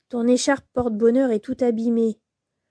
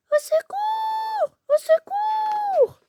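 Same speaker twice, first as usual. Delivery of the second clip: much higher, slow, distant calls; cry for help from afar; much slower than usual. Acted. (appel au secours lointain, très aigu)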